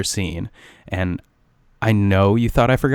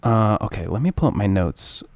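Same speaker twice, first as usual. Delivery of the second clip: ordinary talk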